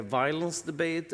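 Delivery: usual speaking voice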